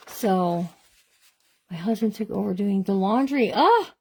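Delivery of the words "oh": surprised oh. Real, not acted. Reacting to husband doing laundry.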